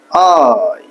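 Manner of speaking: "আই" stretched slowly with a gliding fall; long drawn-out vowel. Shown as a demonstration. Teacher showing learners to stretch the letter, drawn-out "আই". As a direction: draw out the vowel in "আই" at length. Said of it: "লম্বা করে টেনে একটু পড়তে হবে".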